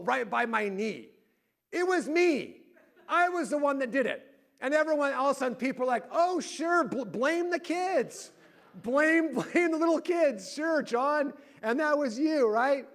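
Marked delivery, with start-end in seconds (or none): 6.1-12.9 high, loud sarcastic jeering, retold; mock sarcasm. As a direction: imitate relatives piling on with sarcasm. Family mocks him for blaming kids.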